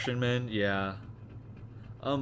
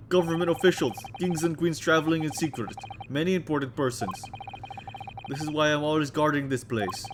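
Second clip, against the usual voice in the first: Russian crewman; 2 semitones higher than usual. Acted. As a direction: boom it out like a proud Russian sailor. Stroganoff voice again.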